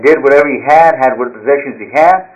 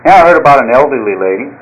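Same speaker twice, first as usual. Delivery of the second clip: ordinary talk, nothing marked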